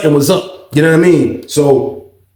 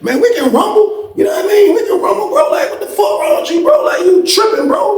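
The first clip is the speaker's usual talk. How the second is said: high-pitched heated shouting; daring him to rumble, voice way up; retold fight challenge. Narrator daring his cellmate to fight, shouting.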